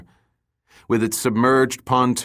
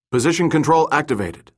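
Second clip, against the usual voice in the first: higher, sharp military call; crisp military bark; 6 semitones higher, 3 dB louder than usual. A narrator's reading. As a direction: bark it like a soldier. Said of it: "barked in a crisp military voice".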